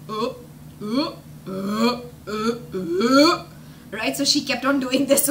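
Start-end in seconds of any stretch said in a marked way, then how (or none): 0.1-3.4 repeated imitated burps; burping. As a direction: burp while running off. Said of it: "we could hear her burp".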